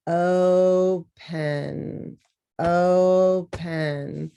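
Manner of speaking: "Open" stretched slowly into two syllables, o-pen; each syllable of "Open" drawn out. Shown as a demonstration. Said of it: "two syllables".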